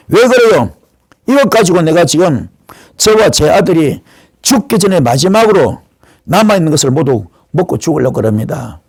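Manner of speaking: ordinary talk, nothing marked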